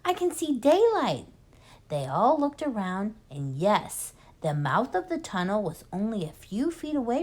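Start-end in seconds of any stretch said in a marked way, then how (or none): 0.0-1.3 excited. Acted. Fox child calls out in tunnel.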